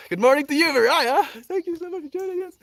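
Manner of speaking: high, loud welcome for Mariah; real joy at a viewer arriving; bright greeting. Real, not acted. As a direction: greet her as if thrilled to see her.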